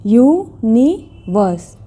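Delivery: यूनिवर्स drawn out, yu... ni... vus; syllable by syllable. Shown as a demonstration. Teacher repeating for learners to count, syllables spaced out. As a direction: separate each beat of यूनिवर्स. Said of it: "three syllables".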